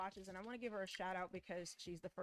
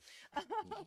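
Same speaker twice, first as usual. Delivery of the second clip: high laugh; 11 semitones higher than usual. Real, not acted. Laughs at own joke.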